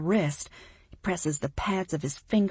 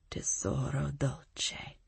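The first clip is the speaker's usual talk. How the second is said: slow whispered Italian endearment; murmur; 4 semitones lower, 7 dB quieter, slower than usual. A narrator's reading. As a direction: breathe it low and slow like a secret. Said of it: "he murmurs".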